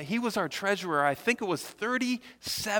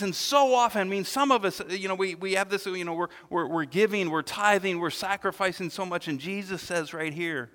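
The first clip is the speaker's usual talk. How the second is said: usual speaking voice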